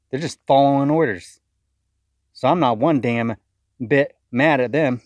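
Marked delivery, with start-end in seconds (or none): none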